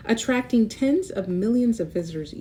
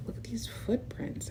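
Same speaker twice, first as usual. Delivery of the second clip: whisper; 3 semitones higher, 9 dB quieter than usual. Acted. Spotting mysterious footprints.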